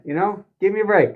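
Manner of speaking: normal talking voice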